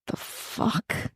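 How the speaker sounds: breathy shocked whisper. Real, not acted. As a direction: gasp it out under your breath. Shocked reaction.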